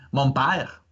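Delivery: Canadian French, drawn-out open vowel in "père"; Canadian French accent. Shown as a demonstration. Host quizzing Sébastien on accents, imitated Canadian French. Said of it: "les gens prononcent l'accent circonflexe".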